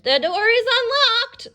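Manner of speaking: the wolf keeps up the granny act. Acted. The wolf in disguise answers in a high sweet granny voice. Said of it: "sweetest Granny voice".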